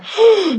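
acted shocked gasp; a crowd's sudden gasp; sharp gasp. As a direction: gasp as if cut to the heart.

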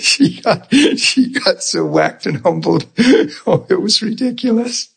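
talking through helpless laughter; laughing too hard to speak smoothly. Real, not acted. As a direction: crack up while recalling it, words breaking into laughs. Cracking up recalling the lunch.

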